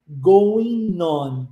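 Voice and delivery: "Going on" run together as go-i-non; linked words. Shown as a demonstration. Teacher demonstrating consonant-to-vowel linking, "Going on" as one word. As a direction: link the N of "Going" into "on". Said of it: "a gente unifica as duas coisas".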